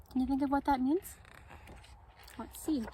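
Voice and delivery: usual speaking voice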